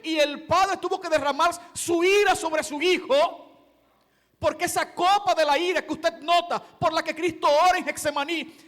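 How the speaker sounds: rising pulpit fervor ending in a shout; fervent preaching. Acted. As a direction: preach it hard, pressing every clause. Preaching at full force.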